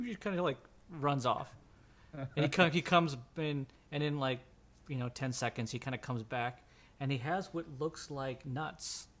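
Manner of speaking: ordinary delivery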